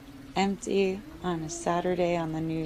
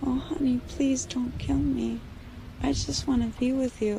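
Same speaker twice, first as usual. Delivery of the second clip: mosquito mom begs her daughter, higher and gentle; pleading mom; 6 semitones higher than usual. Acted. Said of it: "her mom saying".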